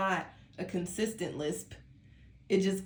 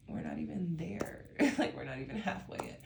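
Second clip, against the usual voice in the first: sleepy whisper; 2 semitones higher, 4 dB quieter than usual. Real, not acted. Sleepy tarot reader talking to viewers.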